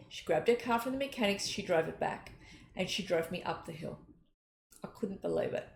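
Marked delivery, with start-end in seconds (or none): 4.8-5.7 hushed, as if still stunned; awed whisper. Real, not acted. Telling listeners of a stranger's kindness, whispered in awe.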